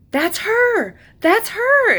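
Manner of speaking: high, loud cry of joy; inner scream of recognition. Retold or imitated. Recognizing her soul, crying out high and loud. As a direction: shout out that it's her. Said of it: "like, screaming".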